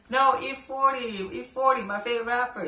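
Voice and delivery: high, insistent repeats across a language barrier; insistent coworker. Acted. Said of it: "like, a language barrier".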